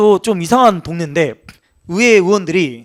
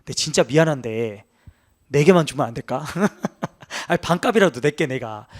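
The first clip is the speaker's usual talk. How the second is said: sheepish pleading; 5 semitones lower, 6 dB quieter than usual. Retold or imitated. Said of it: "이러면".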